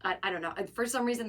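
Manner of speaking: normal talking voice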